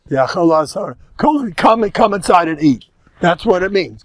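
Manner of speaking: ordinary talk, nothing marked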